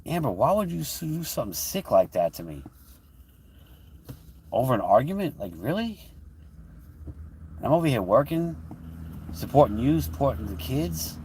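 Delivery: retold hurt outburst. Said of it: "I'm like".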